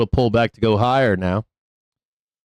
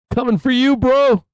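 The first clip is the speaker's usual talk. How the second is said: playful threat; 14 semitones higher, 3 dB louder than usual. Pretend. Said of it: "We're gonna say".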